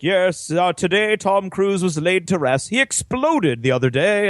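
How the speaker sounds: slow, lightly voiced newsreader; a hint of a solemn news voice; mock news anchor. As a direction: read it like a TV bulletin. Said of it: "you'll hear news in the background".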